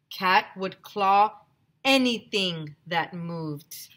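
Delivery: plain reading voice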